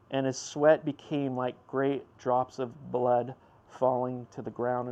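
normal talking voice

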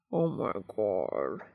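low, slow groan of pleasure; a long low groan of bliss over food. A narrator's reading. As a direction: groan it out deep and drawn. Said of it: "he groans".

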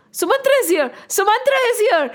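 urgent high announcement shout; servants shouting. Acted. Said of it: "shouted the servants".

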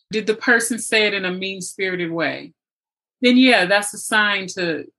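ordinary talk, nothing marked

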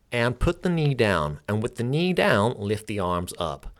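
comic mock-American class cue; terrible American accent. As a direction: do a bad generic American accent, projecting to a class. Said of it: "You can laugh at my terrible American accent".

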